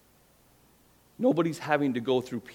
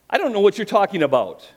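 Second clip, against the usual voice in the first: higher, louder flustered denial; 8 semitones higher, 7 dB louder than usual. Acted. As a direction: brush her off in a panic. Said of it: "he said".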